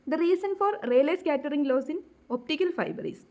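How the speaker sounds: reads the question out very slowly, word by word; slow question reading. Acted. Teacher reading exam question aloud to students, very slow dictation.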